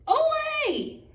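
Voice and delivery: very high, loud sung-out Ole; a farm wife's high, long cheer from her kitchen; farmer's wife cheering. A narrator's reading. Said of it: "and Missus Nut Thatcher said".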